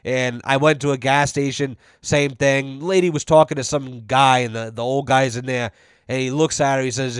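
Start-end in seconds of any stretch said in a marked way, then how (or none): none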